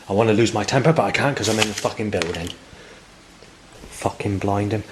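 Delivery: fighting to keep his temper; real anger. Real, not acted. Venting about the voices, real held-back rage. Own temper boiling over.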